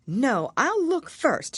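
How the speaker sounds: put-on high, slow and careful little girl; a prim young girl deciding to be careful; Alice's voice. Alice eyes the Drink Me bottle, slow and careful.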